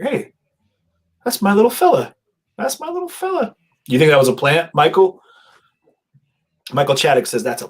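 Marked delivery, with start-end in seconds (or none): 0.0-2.1 mock proud mom, pitched much higher. Streamer imitating a viewer's mom spotting her son, high doting voice. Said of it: "she's like". 2.6-3.6 even higher, squeaky mock mom; doting mom voice. Streamer repeating the mom's line, squeakier doting mom voice. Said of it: "she's like".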